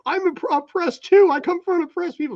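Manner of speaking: high, slow whiny cartoon dad voice; high whiny mock lament; mocking Randy. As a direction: whine like Randy from South Park. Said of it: "He's like".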